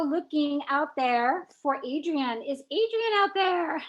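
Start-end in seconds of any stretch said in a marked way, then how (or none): 2.4-3.8 high, ringing call out; calling from far away. Real, not acted. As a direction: call out into the crowd. Host calling for missing winner.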